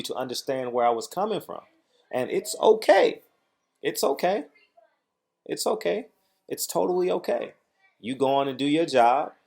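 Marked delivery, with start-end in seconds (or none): 2.1-7.5 mock reassurance. Real, not acted. Sarcastic reassurance at rival promoter.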